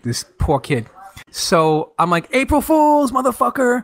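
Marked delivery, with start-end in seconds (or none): 2.3-3.8 high, crowing retold gotcha; spring the joke on them, pitch jumping up; gleeful prank reveal. Springs the prank on his friend.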